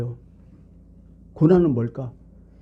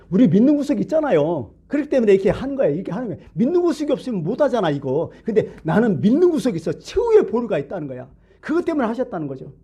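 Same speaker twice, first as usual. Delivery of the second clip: high-pitched, insistent sermon delivery; impassioned preaching; 8 semitones higher than usual. Real, not acted. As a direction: drive the point home to the congregation, pitched well up. (열띤 설교조, 높은 음성으로 힘주어)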